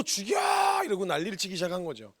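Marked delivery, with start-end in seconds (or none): 0.0-0.8 high shrieking yell at God; shouted kill me. Retold or imitated. Jonah yelling at God to kill him, shrieking. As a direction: scream it out like a furious prophet.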